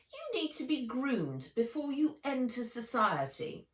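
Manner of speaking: a cold, superior aunt laying down the law; fast, lofty, domineering aunt; haughty aunt. A narrator's reading. Aunt Vera's voice.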